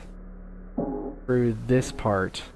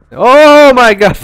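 startled scream; 12 semitones higher, 16 dB louder, slower than usual. Real, not acted. Jump scare in horror game.

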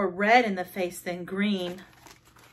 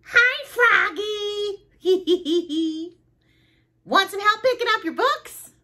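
very high, squeaky character voice; giggly girl frog; 12 semitones higher, 4 dB louder than usual. Acted. Frogalina offers Froggy help, high giggly voice. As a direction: greet in a high voice with a tittering giggle.

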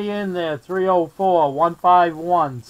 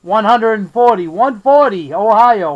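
loud radio call; 2 semitones higher, 7 dB louder than usual. Real, not acted. Calling out over CB radio.

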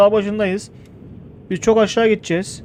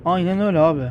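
ordinary delivery

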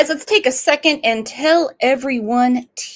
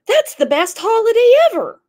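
put-on high girlish whine; girlish protest; 11 semitones higher, 4 dB louder, faster than usual. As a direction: play the protesting stepdaughter. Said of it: "She goes".

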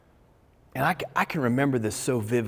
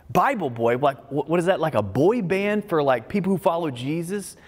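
higher, louder mock-puzzled question; incredulous ridicule; 6 semitones higher, 4 dB louder than usual. Speaker recalling thoughts on being called Bible boy, incredulous. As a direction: replay an inner reaction to a ridiculous label. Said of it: "that sounds so ridiculous to me".

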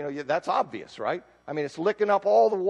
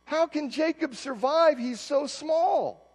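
pleading cry; 8 semitones higher, slower than usual. Acted. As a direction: beg the Lord for mercy, voice lifted high. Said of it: "I cried out".

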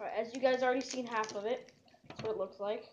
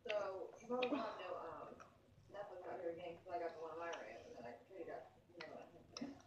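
hushed; 6 semitones lower, 17 dB quieter, faster than usual. Real, not acted. Hushed aside, others sleeping.

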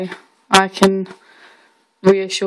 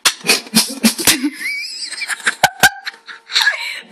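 choking cough mixed with laughter; sputtering, gasping coughs; coughing fit. Real, not acted. Cinnamon challenge, coughing after spoonful.

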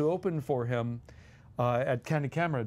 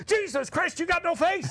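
put-on much higher, loud panicked child cry; horrified shriek; 14 semitones higher, 3 dB louder than usual. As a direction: shriek in comic horror. Boy discovers scrubbed-off face.

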